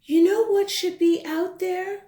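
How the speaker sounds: put-on high, eager little girl asking; bright child voice. Child character asks.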